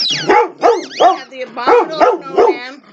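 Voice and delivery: high, loud sing-song presenter reveal; showy reveal. Pretend. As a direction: unveil the toy like a game-show host. Presenting toy with flourish.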